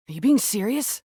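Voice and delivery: usual speaking voice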